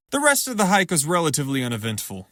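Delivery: ordinary delivery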